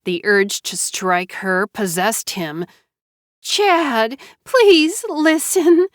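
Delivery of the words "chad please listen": high, slow, loud plea; pleading Mara Joy. Acted. Wife pleading with her husband, high slow plea. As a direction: beg him to hear you out.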